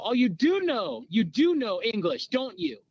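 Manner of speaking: swooping pitch, leaning hard on DO; mock taunting. Captors to captive who spoke English, mock taunting. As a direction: gloat at catching someone out, acted.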